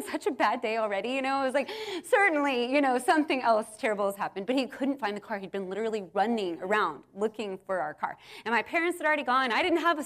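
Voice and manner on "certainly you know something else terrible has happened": ordinary talk, nothing marked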